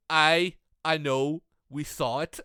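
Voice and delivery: slow, dry, unimpressed reply; deadpan fan. Retold or imitated. Fan turning round to shut up the commentator, slow and dry. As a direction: flatten him with slow sarcasm. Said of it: "turns around and goes".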